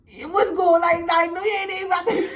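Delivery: high and laughing, the words breaking into giggles; can barely get it out for laughing; real laughter over a mock retort; laughing through it. Real, not acted. Laughing while retelling husband.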